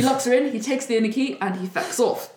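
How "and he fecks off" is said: ordinary delivery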